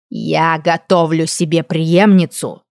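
put-on slow, ringing old hag voice. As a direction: ring out each word slowly like a crone. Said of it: "звенящим голосом проговорила старая карга".

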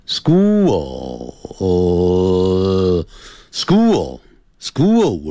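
SCHOOL hugely stretched, holding the dark L with the tongue tip up; very slow "school", tongue curled up behind the top teeth for the L; school with final L. Shown as a demonstration. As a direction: draw out the end of "school" into a long L. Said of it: "舌尖抵起来".

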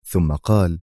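usual speaking voice

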